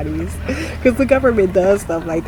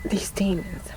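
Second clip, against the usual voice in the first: soft whisper under the breath; whispered aside; 6 dB quieter than usual. Real, not acted. To herself about the world, whispered. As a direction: breathe it to yourself. Muttered aside after disbelief.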